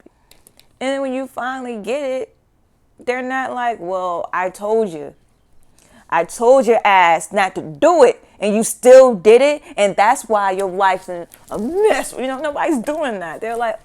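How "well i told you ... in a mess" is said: scolding. Retold or imitated. Scolding voice imagined.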